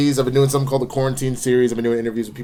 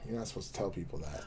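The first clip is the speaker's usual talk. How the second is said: very soft, quick mutter; hushed aside; 4 semitones lower, 18 dB quieter, faster than usual. Real, not acted. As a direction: say it under your breath, as if keeping a secret. Mock secret let out by friend.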